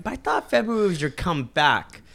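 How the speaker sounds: mocking friend's question. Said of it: "You're like".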